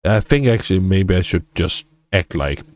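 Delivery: ordinary talk, nothing marked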